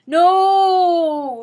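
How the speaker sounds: loud, high, long cry of no; shout. Acted. Lupin shouting as chains bind him. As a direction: howl it out as the chains come. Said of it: "Lupin shouted".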